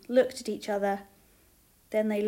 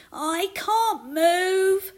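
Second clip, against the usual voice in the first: put-on high, loud, long-drawn child's wail; little brother; 10 semitones higher, 7 dB louder, much slower than usual. A narrator's reading. Little boy squashed in a crowd, childish wail. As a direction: stretch it out like a stuck child. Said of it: "said her little brother".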